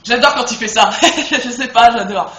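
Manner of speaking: loud, laughing gush; delighted laugh. Real, not acted. Gamer laughs with delight watching Link's move again.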